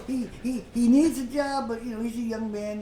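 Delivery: normal talking voice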